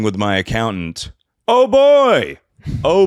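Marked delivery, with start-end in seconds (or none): 1.5-3.1 high, loud exclamation of dread. Real, not acted. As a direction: throw it up high like a groan of doom. Dreading meeting with accountant.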